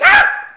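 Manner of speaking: hammed-up enraged scream; furious scream. Commandant losing it at the prisoners, screamed. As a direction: explode into a high scream.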